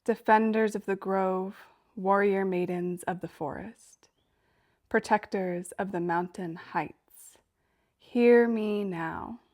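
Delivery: loud, measured incantation with ringing line openings; ritual invocation. Acted. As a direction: summon protectors in a chanted, declamatory prayer. Recites an invocation to nymphs.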